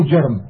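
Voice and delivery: جرم said "jarm", a after the j; fatha on جرم. Shown as a demonstration. Reader naming a Yemeni tribe, جرم with fatha, "jarm". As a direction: open short a in جرم. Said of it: "بفتح الجيم".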